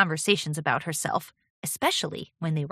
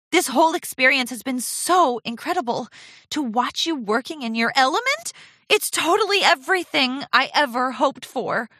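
put-on higher, keen, admiring young pony; gushing apprentice; 7 semitones higher, 4 dB louder than usual. A narrator's reading. Apprentice reassuring her mentor, gushing. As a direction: gush sincerely, voice lifted.

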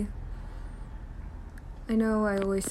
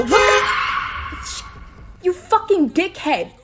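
furious shout at another driver; road rage; 13 semitones higher, 8 dB louder than usual. Acted. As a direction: scream at the car that ran the light. Driver runs red light.

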